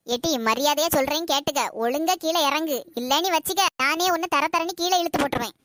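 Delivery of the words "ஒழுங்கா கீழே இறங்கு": ordinary talk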